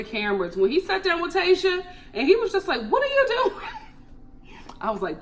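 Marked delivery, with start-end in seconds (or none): 2.9-3.6 Tayshia's dad questions her, a high incredulous imitation; a high, incredulous mock dad; stern dad. High, disbelieving dad asking what she's doing. Dad confronting daughter on date.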